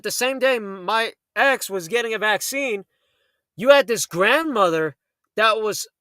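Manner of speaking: ordinary talk, nothing marked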